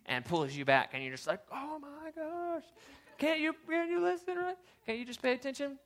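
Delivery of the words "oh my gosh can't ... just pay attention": high, strained whiny complaint; exasperated put-on voice. Paddler complaining to the guy who grabbed his paddle, high whine. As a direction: whine at the paddler behind you. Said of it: "why am I using that voice?".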